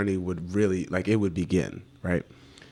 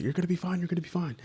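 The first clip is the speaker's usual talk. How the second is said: high, soft reassurance; reassuring friend; 11 semitones higher, 4 dB quieter, much faster than usual. Retold or imitated. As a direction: comfort him gently, up high. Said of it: "And he's like".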